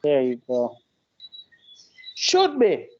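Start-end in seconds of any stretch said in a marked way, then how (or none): 2.2-2.9 "Should" drawn out high, then falling; stress on SHOULD. Shown as a demonstration. Backing up the friend's point, stress on "Should". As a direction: lean hard on "Should" with raised pitch. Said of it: "Emphasis on the word should".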